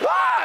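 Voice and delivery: loud, very high imitated baby scream; long piercing mock wail; newborn's scream. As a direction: scream like a newborn in full cry. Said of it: "That's what she's doing".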